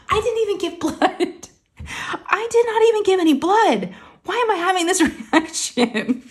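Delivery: high-pitched incredulous squeal through laughter; laughing disbelief. Real, not acted. Patient admitting she fainted without giving blood, laughing disbelief. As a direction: crack up at yourself, voice way up. Laughing at her own absurd reaction.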